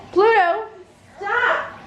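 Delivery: calling out. A narrator's reading. Calling the dog to stop.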